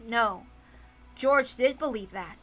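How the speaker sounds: normal talking voice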